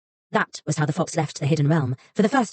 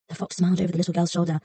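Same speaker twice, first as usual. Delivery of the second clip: plain reading voice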